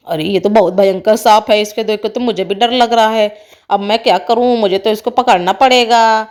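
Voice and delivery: low man's voice, mildly worried; deep-voiced charmer with a touch of fear; nervous snake charmer. Snake charmer secretly scared.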